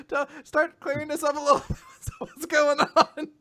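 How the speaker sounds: high, giggly quote; squeaky, laughter-broken delivery; laughing through it. Retold or imitated. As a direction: act confused while cracking up. Laughing at acted confusion.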